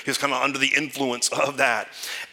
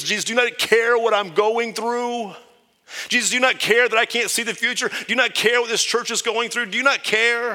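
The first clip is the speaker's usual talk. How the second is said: higher, louder pleading cry; desperate plea to Jesus; 9 semitones higher, 3 dB louder than usual. Retold or imitated. Frightened believer pleading with Jesus, higher, louder cry. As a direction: beg Jesus like you're sinking in the storm. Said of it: "and I tell, tell Jesus".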